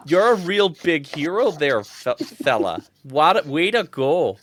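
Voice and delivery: gushing villager. Acted. Villager congratulating Bill, gushing. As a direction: cheer the hero on as a villager.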